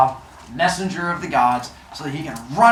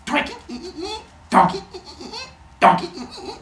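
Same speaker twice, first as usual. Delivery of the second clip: high squeaky stab sound effects; repeated knife punches with squeals; imitated stabbing noises. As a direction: mimic a blade poking through shell.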